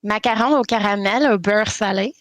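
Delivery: playful Québécois lilt on au caramel; French Canadian accent. Pretend. Canadian player showing off her French accent. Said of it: "it sounded Canadian".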